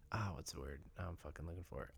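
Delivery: whisper. Real, not acted. Searching for a word aside.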